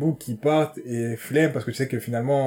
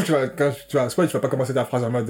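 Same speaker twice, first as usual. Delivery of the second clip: ordinary delivery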